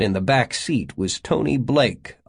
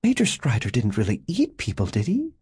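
a hint of a doubtful woman; lightly voiced Janet.

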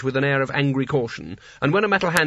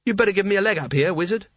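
fast, curt command; brisk order; 6 semitones higher, faster than usual. Acted. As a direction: snap the order out quickly. Kanina orders Rincewind.

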